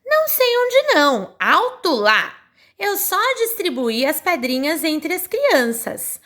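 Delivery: put-on a boy's indignant protest, pitched high; voicing Telim. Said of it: "quando ele fala assim".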